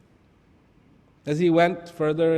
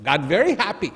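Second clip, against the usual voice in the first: normal talking voice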